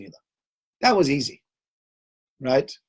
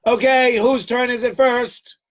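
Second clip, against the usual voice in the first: much higher, louder, slow sing-song; mock cheery leader; 9 semitones higher, 5 dB louder, slower than usual. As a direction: call them up like a game host. Leader wrongly lining people up.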